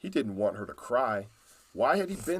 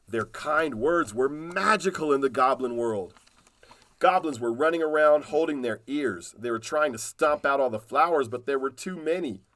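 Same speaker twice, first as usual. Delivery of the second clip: ordinary delivery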